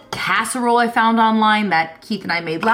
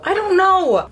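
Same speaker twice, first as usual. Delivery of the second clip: exasperated; 11 semitones higher, 3 dB louder than usual. Real, not acted.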